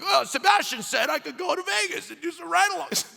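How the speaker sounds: high-pitched mock excuse; playful imitation of someone pleading permission; mimicked excuse-maker. Mimicking someone blaming him.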